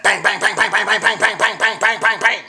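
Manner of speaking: loud, high bang bang gunfire chant; hammed-up thug spraying bullets, loud and high; mock gunfire. Joking about playing a thug, loud high mock gunfire. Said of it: "I could be a thug and take out my A K forty-seven and be like".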